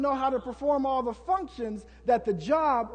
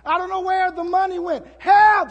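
high, loud, pleading cries of help; frantic shouted prayer, much louder and higher; desperate shout for help; 6 semitones higher, 9 dB louder than usual. Acted. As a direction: yell it like you're drowning and only God can hear. Acted desperate cry for help.

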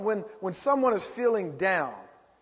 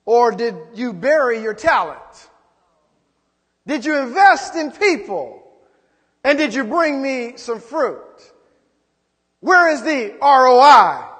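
louder, higher, demanding God; 4 semitones higher, 9 dB louder than usual. Acted. God questioning the servant, demanding and loud. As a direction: press hard for the return on the talent.

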